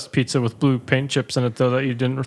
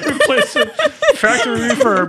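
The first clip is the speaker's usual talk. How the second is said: mock service rep; 13 semitones higher, 4 dB louder than usual. Mock customer service reply.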